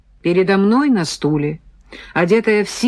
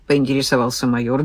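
ordinary delivery

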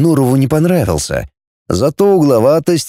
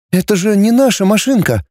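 higher, mildly feeble protest; weakly; 9 semitones higher than usual. A narrator's reading. Girl protests taking someone else's car, weakly. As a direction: object timidly, without force. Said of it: "слабо проговорила Наташа".

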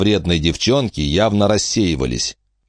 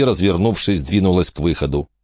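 ordinary delivery

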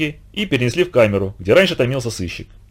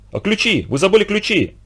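окрик; 7 semitones higher than usual. A narrator's reading. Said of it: "крикнул никогда не терявший самообладание Кусибаба".

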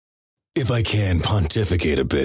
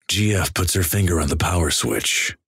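ordinary delivery

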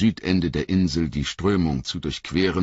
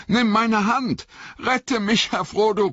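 high, panicked plea from the water; panicked gasping; 4 dB louder than usual. Acted. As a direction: gasp it out like you're drowning. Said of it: "keuchte Sam".

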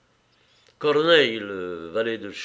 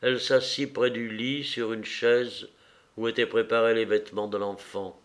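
ordinary delivery, nothing marked

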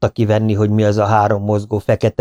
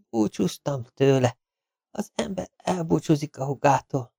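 soft and high, fading; faint weak voice; 7 semitones higher, 7 dB quieter than usual. A narrator's reading. Wounded Smith explains the kiss to Ronnie, faintly. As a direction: speak with failing strength.

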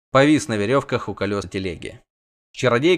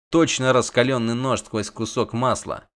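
usual speaking voice

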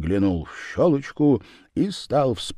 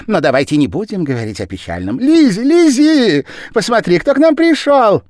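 very high, loud call up the stairs; 13 semitones higher, 9 dB louder than usual. A narrator's reading. The Japanese man calling Lizzie down, high and loud.

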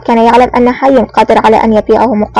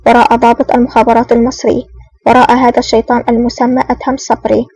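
usual speaking voice

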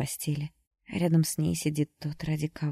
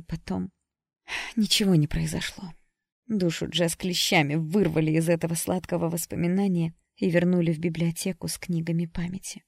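ordinary delivery

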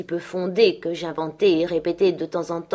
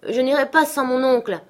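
put-on higher, protesting cry of a boy; raised, insistent child's protest; child's outcry; 6 semitones higher, 5 dB louder than usual. A narrator's reading. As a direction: cry out in stubborn refusal. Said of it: "s'écria-t-il enfin".

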